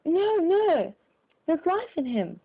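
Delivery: high, loud urgent shout; shouted protest. A narrator's reading. Bystander shouting back at the crowd, high and urgent. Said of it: "shouted another".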